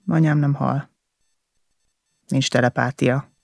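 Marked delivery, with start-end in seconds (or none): none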